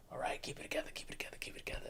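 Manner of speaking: unvoiced whisper holding back tears; queen steeling herself; whispered to self. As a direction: whisper to yourself fighting tears. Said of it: "trying to hold back tears".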